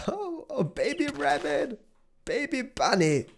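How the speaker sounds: very high cooing squeal; high-pitched adoring excitement; gushing delight. Real, not acted. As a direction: gush over a tiny bunny. Spots a baby rabbit.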